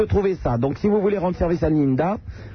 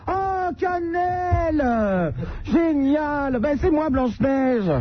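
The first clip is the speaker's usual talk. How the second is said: high, drawn-out squeal of fake joy; mock gushing delight; 9 semitones higher, much slower than usual. Host squealing to caller about her CB alias, hammed-up and high. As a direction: gush like a girl spotting her best friend.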